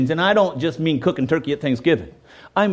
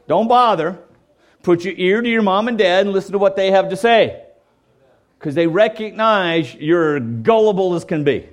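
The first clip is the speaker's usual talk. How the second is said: normal talking voice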